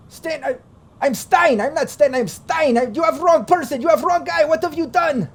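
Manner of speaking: high-pitched panicky foreign prisoner; panicked captive. Acted. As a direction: squeal out the denial in a panic. GM voices panicked prisoner Stein.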